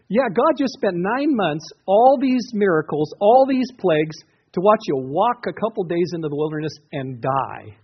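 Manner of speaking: high, loud mock disbelief; incredulous sarcasm. Preacher mocking Israel's complaint about dying in the wilderness, sarcastic. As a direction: hammer out each absurd point, pitched high, then drop on the payoff.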